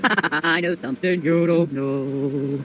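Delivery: a slow, low, teasing drawl; slow deep taunt. Pretend. Taunting viewers with a slow, low singsong.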